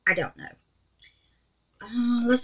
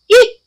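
short, high, loud eek; startled squeal; 7 dB louder than usual. Real, not acted. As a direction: let out a quick high squeak. Startled while pouring resin.

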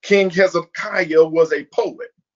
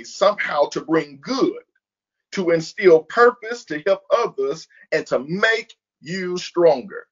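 ordinary talk

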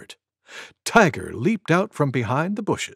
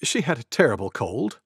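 ordinary delivery

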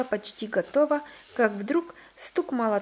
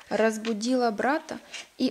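ordinary delivery, nothing marked

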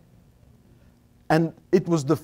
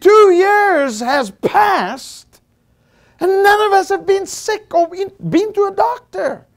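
shouting to God; 13 semitones higher, 5 dB louder than usual. Retold or imitated. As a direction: cry it out to God, stretching each word. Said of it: "and I shouted".

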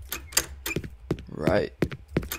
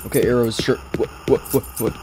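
normal talking voice

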